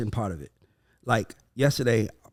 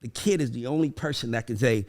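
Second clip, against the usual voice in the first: normal talking voice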